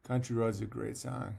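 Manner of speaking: ordinary talk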